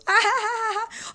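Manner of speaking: put-on the bully girl cackles after her prank call; mocking cackle. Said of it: "Kim's laughing and cackling".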